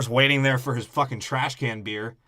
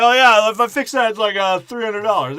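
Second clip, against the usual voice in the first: mock salesman hype; 8 semitones higher, 10 dB louder than usual. Dad hyping junk he overprices.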